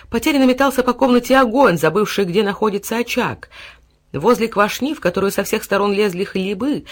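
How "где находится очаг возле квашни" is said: usual speaking voice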